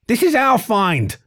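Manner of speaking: high, slow possessive snarl. Acted. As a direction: claim it through bared teeth. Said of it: "snarled the smaller fennec".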